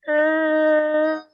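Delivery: high wailing cry; primal cry wail. Retold or imitated. Alone at home, mourning his father: high wail. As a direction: let out a raw grief wail. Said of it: "you get primal cries when you lose someone".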